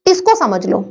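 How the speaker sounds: ordinary delivery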